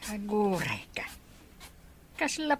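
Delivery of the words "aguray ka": whisper. Acted. Father pauses, recognizing the suitor.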